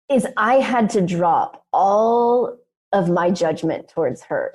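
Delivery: ordinary delivery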